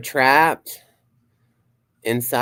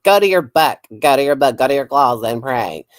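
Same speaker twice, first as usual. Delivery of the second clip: loud, high mocking preacher scold; hammed-up churchy nag, much louder; 3 semitones higher, 8 dB louder than usual. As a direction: bark it like a pushy churchgoer. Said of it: "Where they're like".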